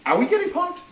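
a band reacting to the lineup, incredulous; mock disbelief. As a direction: squeak it out in disbelief.

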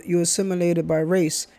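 ordinary talk

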